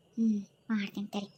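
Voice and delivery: low, hushed threat; a soft, sinister promise; menacing vow. Acted. Scheming wife vows revenge, low and soft.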